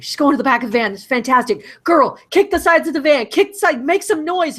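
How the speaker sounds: building from keen to high, loud cheering-on; excited urging. Retold or imitated. Viewer urging the kidnapped girl on, acted rising excitement. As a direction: urge her on, pitch climbing as you go.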